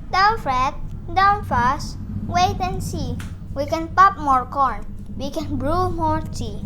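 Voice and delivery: a tiny mouse soothing a big bear; bright squeak of reassurance; squeaky mouse. Acted. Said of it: "Mouse squeaks".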